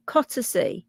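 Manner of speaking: Cottersea said "COT-uh-see"; corrected pronunciation. Shown as a demonstration. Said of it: "It's pronounced".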